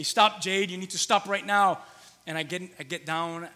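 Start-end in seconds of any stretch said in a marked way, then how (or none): 0.0-1.8 put-on firm raised voice telling a toddler to stop; louder, a parent laying down the law; dad warning his three-year-old to stop, firm and raised; stern father warning. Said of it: "And I say".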